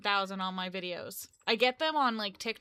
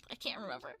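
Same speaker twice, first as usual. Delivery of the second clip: much softer, lower, dropping away; quiet admission; 5 semitones lower, 11 dB quieter, much faster than usual. Real, not acted. Vlogger forgetting birthday, admitting it softly. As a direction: let it fall away under your breath. Trailing off to herself.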